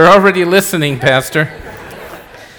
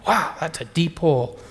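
hushed awe; 7 dB quieter than usual. Retold or imitated. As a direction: breathe it out amazed. Drawn-out awe after long pause.

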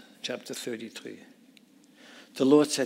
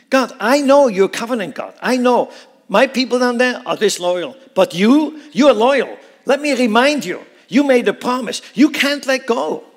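loud, high, near-shouted pleading; impassioned plea; 10 semitones higher, 11 dB louder than usual. Acted. Moses reminds God of his promise: high, loud pleading. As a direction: cry out to God, holding him to his word.

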